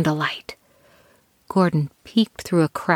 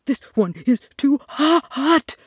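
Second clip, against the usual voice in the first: scalded mouth; 7 semitones higher than usual. Acted. Burnt by porridge.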